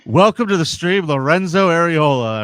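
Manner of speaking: loud host introduction; announcer welcome. Acted. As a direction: boom it like an announcer. Welcoming guest on stream.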